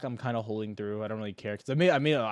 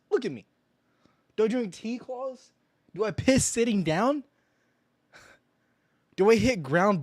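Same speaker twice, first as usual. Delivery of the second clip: mock offended rant; 7 semitones higher, 7 dB louder than usual.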